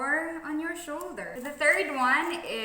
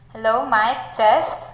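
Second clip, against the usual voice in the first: much louder, through a small PA speaker; amplified mic test voice; through a voice amplifier; 11 dB louder than usual. Shown as a demonstration. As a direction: speak into a portable amp. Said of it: "this is my normal voice".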